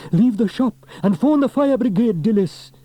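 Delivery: high, loud call to clear the shop; urgent shout. A narrator's reading. Man warning shopkeeper of fire, loud urgent shout. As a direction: bark the order over the fire. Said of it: "he shouted".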